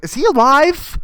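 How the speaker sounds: hammed-up alarmed bystander; mock alarm. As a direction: call out in pretend panic. Mock alarm at teacher's confession.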